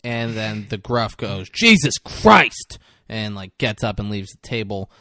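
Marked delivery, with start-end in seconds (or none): 1.5-2.8 loud, slow, high exasperation; mock exasperated outburst; exasperated oath. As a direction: drag out both words, fed up. Fed-up man leaves the table.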